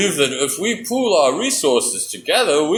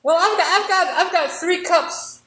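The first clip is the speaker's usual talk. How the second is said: eager mouse; 10 semitones higher than usual. Acted. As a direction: rush around like a busy little mouse. Mouse voice in skit.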